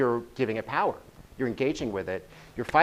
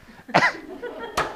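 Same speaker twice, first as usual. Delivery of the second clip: breathy laugh. Real, not acted. Audience reaction, speaker laughs.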